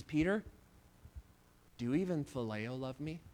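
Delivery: soft and low, coming down to his level; a tender, hushed Jesus, low and soft; gentle hushed question. Acted. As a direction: lean in and ask it near a whisper. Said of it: "he comes down to his level".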